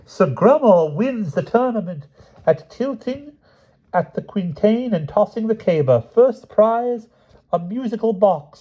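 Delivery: high, slow, ringing herald call; a herald proclaiming the winner to the court; herald's announcement. Acted. Herald announcing tournament results.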